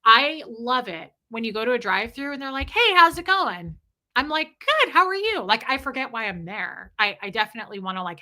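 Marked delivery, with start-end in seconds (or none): 2.7-3.8 high, bright-voiced drive-through hello, loud; acted cheery server greeting; friendly greeting. 4.6-5.4 high, loud, delighted small talk; overkeen, pitched-up chattiness; eager friendly reply. Retold or imitated. Acting own keen reply at drive-through.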